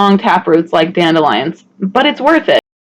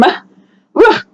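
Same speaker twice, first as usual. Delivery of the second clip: high grossed-out groan; disgusted ugh; 11 semitones higher than usual. Real, not acted. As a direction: recoil from the slime. Reacting to slimy leaf.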